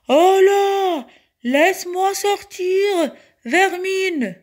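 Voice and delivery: high, loud outraged shout; drawn-out, furious genie yell. A narrator's reading. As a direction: holler to be let out of a bottle. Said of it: "Le génie hurla encore".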